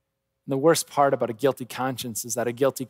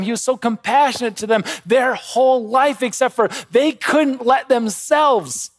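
higher, louder, driving sermon voice; preaching at full force; 7 semitones higher, 4 dB louder than usual. Acted. Pastor pressing the congregation about the brothers' scheme, fervent. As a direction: build the outrage at the brothers.

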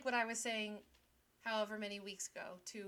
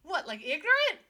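loud, high joking retort; mock indignation; 6 semitones higher, 10 dB louder than usual. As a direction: throw the insult back loudly in jest. Repeats the mock insult.

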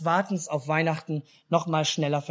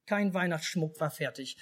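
ordinary delivery

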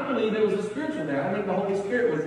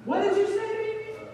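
much higher, indignant challenge; defensive retort; 13 semitones higher than usual. Retold or imitated. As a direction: fire back, offended. Retelling his triggered retort.

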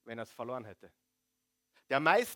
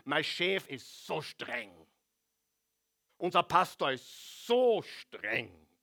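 mock whiny complainer; slower than usual. Mocking complainers' excuses.

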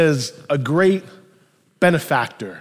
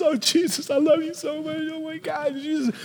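high-pitched tearful gushing; hammed-up weeping adoration, high and thin on volume; imitated crying; 9 semitones higher, 4 dB quieter than usual. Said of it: "she's just crying".

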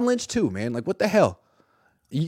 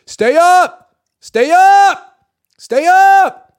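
loud high shouted call; coaches yelling; 12 dB louder, much slower than usual. Retold or imitated. Reporter imitating assistant coaches yelling at players. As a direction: bark it across the practice field. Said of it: "you would hear coaches, assistant coaches".

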